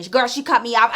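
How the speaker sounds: ordinary talk, nothing marked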